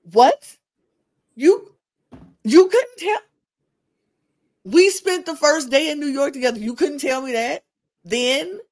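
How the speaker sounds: high, loud shocked Kayla; mock-shocked girlfriend, high and loud; imitating Kayla. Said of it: "And Kayla was like".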